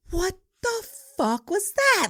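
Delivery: high, drawn-out disbelief; retold bafflement. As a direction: stretch each word, utterly baffled. Baffled as driver takes off.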